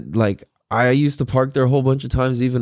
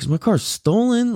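higher, startled question; retold panic; 10 semitones higher than usual. Owner finds his car missing, higher startled panic. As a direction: sound suddenly alarmed. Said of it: "I'm just like".

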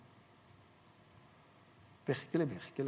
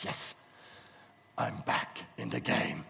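slow, hushed, breathy gloating; mock-triumphant whisper to himself; sly whispered gloat; 7 semitones lower, 5 dB quieter, slower than usual. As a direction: whisper it like a secret victory. Pastor gloats after hanging up.

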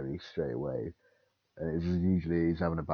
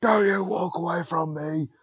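much louder, higher yell at a pupil walking off; angry shout; 11 semitones higher, 6 dB louder than usual. Retold or imitated. Teacher yelling at a pupil walking away, loud angry shout. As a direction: bellow after someone walking away. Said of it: "you can say".

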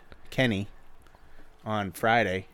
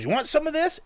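high put-on Kenny voice. Friend retelling what the grill guy said, high put-on voice. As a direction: squeeze the voice up high like an old cook.

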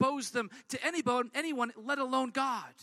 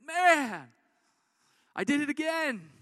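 exasperated cry; 4 semitones higher, 6 dB louder than usual. Acted. Sinner voiced after relapse.

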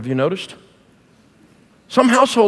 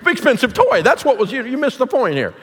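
mock frantic parent; 7 semitones higher than usual. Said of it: "I'm like".

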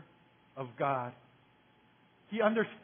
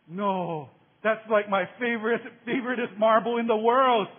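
whiny protest; 4 semitones higher, 6 dB louder than usual. Retold or imitated. Kid protesting to bully over marble.

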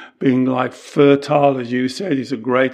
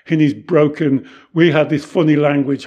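ordinary talk

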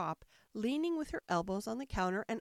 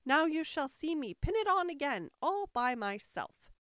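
higher, proud queen; triumphant; 6 semitones higher, 4 dB louder than usual. A narrator's reading. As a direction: boast of your success. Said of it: "she cried in a triumphant tone".

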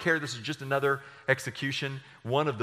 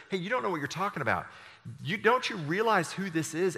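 quick, higher reproach; rebuking; 6 semitones higher than usual. Retold or imitated. Good thief rebukes the mocker, quick mild reproach. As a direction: snap back at the other criminal. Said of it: "he rebukes the other criminal".

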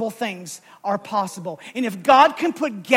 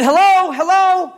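raised voice, calling hello into an empty room; 7 semitones higher, 8 dB louder than usual. Retold or imitated. Calling into the empty station for the clerk, raised voice. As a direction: call out to find the vanished clerk.

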